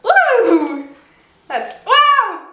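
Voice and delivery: high, loud whoop of delight; excited whoop. Real, not acted. Baker whooping as the yolk squirts out. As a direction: cheer at the squirt.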